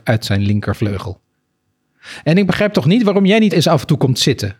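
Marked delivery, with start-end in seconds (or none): 2.2-4.5 higher, sharp reproach to her husband; nagging wife scolding her husband; sharp complaint. Acted. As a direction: needle him, higher and cutting. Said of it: "zei mevrouw Mus snerpend".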